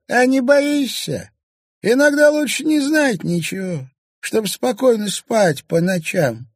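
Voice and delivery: hint at dark dangers; ominous old crone. A narrator's reading. Old woman warns the journalist, high and ominous.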